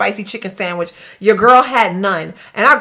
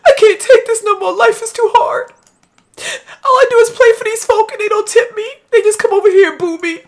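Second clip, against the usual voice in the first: mock tearful musician; 10 semitones higher, 4 dB louder than usual. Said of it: "She was like".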